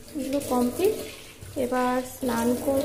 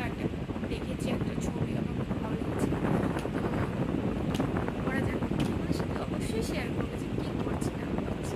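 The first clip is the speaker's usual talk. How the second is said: whispering; 5 dB quieter than usual. Real, not acted. Whispered chat while painting.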